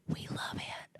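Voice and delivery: whispered. Retold or imitated. Texan followers answering host, whispered. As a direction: whisper the line with no voice. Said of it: "answered back and said".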